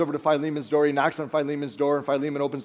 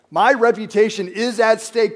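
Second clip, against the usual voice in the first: raised, insistent complaint; worried protest; 5 semitones higher, 6 dB louder than usual. Retold or imitated. Philemon pleading with Paul over his standing, insistent. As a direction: press the point as a worried businessman. Paul, don't you know that my reputation is at stake?.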